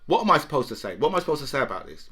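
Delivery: ordinary delivery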